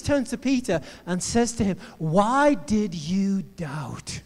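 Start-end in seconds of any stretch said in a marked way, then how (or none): 2.0-4.2 Jesus's question drawn right out; very slow, weighted delivery; slow, deliberate question. Retold or imitated. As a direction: stretch every word of the question. Acts Jesus questioning Peter.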